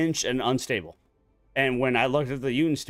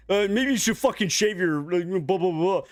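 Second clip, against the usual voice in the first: a lightly voiced, higher mocking whine; mocking coworker; 7 semitones higher, 3 dB louder than usual. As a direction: mimic the coworker scornfully. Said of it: "He was just like".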